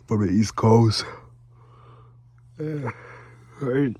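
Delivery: talking through a yawn; stretched yawn on the words. Real, not acted. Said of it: "See, I'm yawning".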